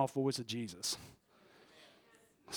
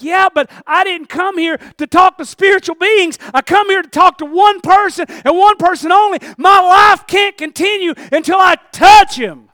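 impassioned pleading shout; 13 semitones higher, 17 dB louder than usual. Acted. Mary insists to the angels she came for one person, impassioned shouting. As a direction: cry it out at full force, each phrase stretched. Acted desperate reply of Mary.

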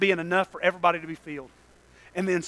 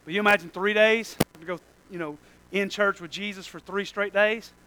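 ordinary delivery

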